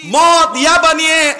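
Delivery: roaring rally cry from the pulpit; full-force preaching. Real, not acted. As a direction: rouse the crowd at the top of your voice.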